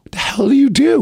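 high, incredulous question; can't believe what she sees; baffled. Retold or imitated. Vicky asks what he does, high baffled question.

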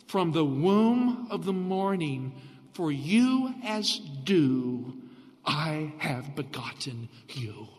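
very slow, each phrase drawn out, fading to a hush; read it slow. Shown as a demonstration. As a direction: savor every word, unhurried. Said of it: "I'm gonna read it slow".

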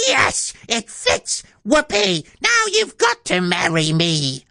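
very high, gleeful screech; high-pitched hammed-up crowing; triumphant scream. A narrator's reading. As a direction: shriek it as a triumphant ugly sister. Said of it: "But she screamed".

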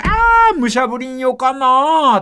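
high, loud admiring cry in Kumamoto dialect. Retold or imitated. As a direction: gush over a bride in Kumamoto dialect. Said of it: "っていう".

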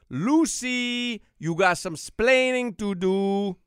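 slow, drawn-out Cuban accent impression. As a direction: do Ricky's Cuban accent, slowly. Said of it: "heavy Cuban accent".

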